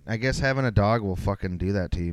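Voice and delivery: ordinary delivery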